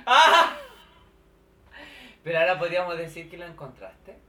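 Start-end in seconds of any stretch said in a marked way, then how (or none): 0.0-0.6 high, loud laughing squeal; laughing shriek. Real, not acted. Laughing shriek at the red underwear.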